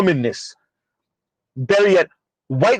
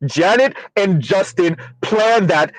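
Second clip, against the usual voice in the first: normal talking voice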